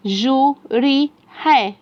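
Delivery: slow, separated syllables; syllable-by-syllable reading. Shown as a demonstration. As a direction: sound out each piece with space between. Slow sounding-out before blended take.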